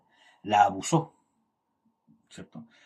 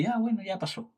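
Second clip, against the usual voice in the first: higher, softer brush-off; dismissive shrug; 8 semitones higher, 6 dB quieter than usual. Retold or imitated. Preacher recalls brushing off an insult, soft dismissive shrug. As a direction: let it go with a light shrug. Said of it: "pero dije".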